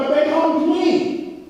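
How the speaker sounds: high, slow mock protest; whiny acted objection. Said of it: "and I'm like".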